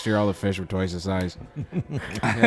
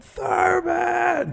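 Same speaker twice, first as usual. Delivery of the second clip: mock yell. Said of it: "And it".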